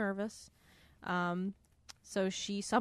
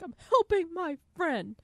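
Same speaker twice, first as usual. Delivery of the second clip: mock Otto voice; 8 semitones higher, 8 dB louder than usual. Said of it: "He's like".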